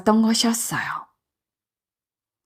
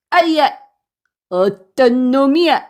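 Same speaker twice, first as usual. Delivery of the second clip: high yelp turning into angry demand; startled, indignant bandit; pained outrage; 3 semitones higher, 3 dB louder than usual. Acted. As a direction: cry out as if suddenly whacked. Bandit struck on the head.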